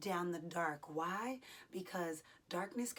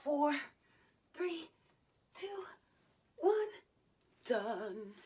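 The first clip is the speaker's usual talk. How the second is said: loud effortful countdown; counting down; 8 semitones higher, 4 dB louder, slower than usual. Real, not acted. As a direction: count down the last seconds. Counting down the exercise.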